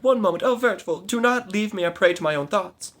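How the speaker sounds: plead, voice lifted and strained; high desperate plea. Acted. Maid begging her knight not to leave, high plea. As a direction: keep the voice high and urgent. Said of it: "she cried".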